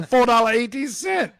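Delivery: high, loud mock Chinese accent shouting; yelling with a Chinese accent. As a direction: yell it like the shop lady through the window, in broken Chinese-accented English. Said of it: "right in my face yelling".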